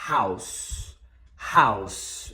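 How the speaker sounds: "House" as one low, drawn-out beat; low pitch, keep "House" in one syllable; one single syllable. Shown as a demonstration. Teacher counting syllables for learners, "House" as one beat. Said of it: "No inglês é só uma sílaba".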